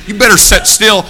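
imitated stink-eye telling-off; stern warning. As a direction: warn them like a cross preacher. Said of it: "giving them the stink eye".

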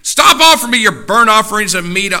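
high, very loud preacher's shout; furious pulpit roar; God's angry rebuke. Acted. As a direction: thunder it at the congregation in disgust.

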